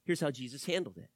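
ordinary delivery

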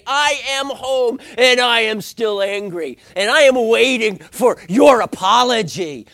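loud, high, stretched-out indignation; acted angry announcement. Angry spouse home and demanding an apology, mock-furious. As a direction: proclaim the grudge at full volume, hammed up.

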